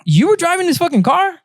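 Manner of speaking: high, disbelieving question; mock amazement; imitated incredulous man. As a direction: retell it with astonished, soaring pitch. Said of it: "the dude was like".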